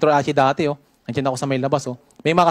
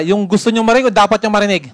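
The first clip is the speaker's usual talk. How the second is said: louder repeat; 4 semitones higher, 6 dB louder than usual. Shown as a demonstration. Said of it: "Gusto ko yung malakas".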